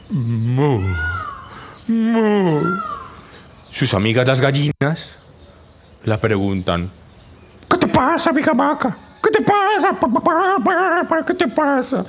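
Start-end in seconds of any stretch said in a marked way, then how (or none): 0.1-2.6 a lightly voiced sad cow moo. Sad cow Macarena mooing to her friends. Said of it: "dice desesperada". 7.7-12.1 high squeaky hen voices; clucking hens. Acted. Hens asking the sad cow what's wrong, squeaky and high.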